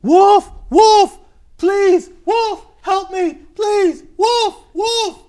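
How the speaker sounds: very high, loud screams for help; play-acted pleading shrieks, one short burst each; desperate shouting. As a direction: yell in panic as the boy facing a wolf. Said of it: "the boy was shouting".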